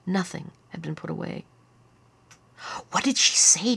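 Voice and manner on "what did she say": put-on higher, insistent child; demanding. A narrator's reading. Lyle asking what Grandma said, demanding. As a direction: demand an answer as an anxious kid. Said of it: "demanded Lyle".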